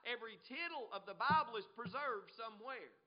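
normal talking voice

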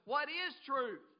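loud, high sarcastic challenge; mocking, scoffing. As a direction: throw it out as a scoffer's taunt. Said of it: "He was mocking. He was scoffing".

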